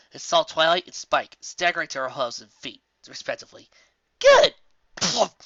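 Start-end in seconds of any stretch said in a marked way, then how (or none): 4.2-5.4 high, loud burst of relief; relieved cheer. Acted. Rainbow Dash relieved her friends survived, high bright cheer. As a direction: beam out a relieved good. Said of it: "she said, smiling".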